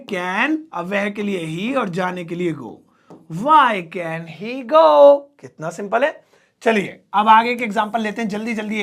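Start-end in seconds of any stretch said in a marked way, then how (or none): 3.4-5.2 slow dictation. Shown as a demonstration. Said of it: "dictating slowly to class".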